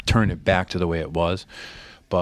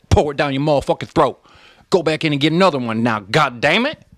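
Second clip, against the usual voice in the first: raised, rolling Southern auctioneer pitch; Southern auctioneer twang; 5 semitones higher, 3 dB louder than usual. Acted. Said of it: "in twang, like Southern twang".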